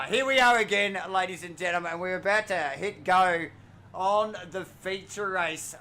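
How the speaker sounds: loud, high hyped announcer; race announcer. Acted. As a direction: pump up the crowd.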